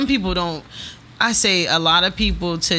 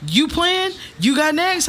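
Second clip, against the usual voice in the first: higher voiced boyfriend asking; 9 semitones higher, slower than usual. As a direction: lightly imitate the boyfriend calling out. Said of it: "my boyfriend's like".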